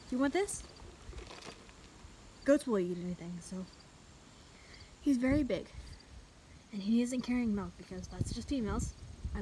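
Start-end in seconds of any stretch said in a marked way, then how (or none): none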